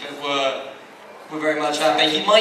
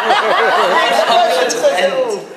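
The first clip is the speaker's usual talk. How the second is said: much higher, louder, slow laughing start; laughing defense; 10 semitones higher, 10 dB louder, much slower than usual. Real, not acted. Panelist laughing as he defends the actor. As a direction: laugh through it at your own reversal. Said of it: "this is a shock twist for me".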